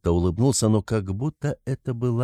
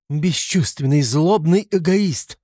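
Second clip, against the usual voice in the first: high, loud, drawn-out accusation; angry cry; 8 semitones higher, 4 dB louder, slower than usual. A narrator's reading. Sister condemns her brother as he leaves, crying out. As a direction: cry out the insult, pitch climbing. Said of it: "вскрикнула Дуня".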